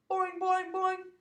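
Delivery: high, loud twangy boings; spring out each boing in agreement; eager frog twangs. Acted. Frog Og replying.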